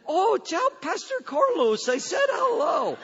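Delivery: joyful call from Peru; excited greeting. Retold or imitated. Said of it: "they'll say".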